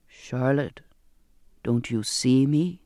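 low, slow, pressing appeal; insistent. A narrator's reading. The apparition pleads with Charlotte to see him, insistent. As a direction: press the question slowly in a low voice. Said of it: "more insistent than before".

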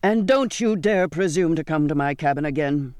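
forceful captain's warning; angry shout. A narrator's reading. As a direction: lay down a furious ban. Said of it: "he shouted".

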